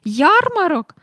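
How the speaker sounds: high, loud burst of excitement; excited astonishment. A narrator's reading. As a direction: exclaim it like a thrilled boy. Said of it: "аж підскочив Майкл".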